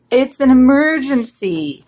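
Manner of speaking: shout. A narrator's reading. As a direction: call out an emergency, stretching it. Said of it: "he shouted".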